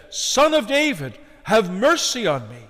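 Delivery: high, loud plea, falling at the end; calling out. Acted. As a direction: cry out for mercy. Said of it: "He called out to Jesus".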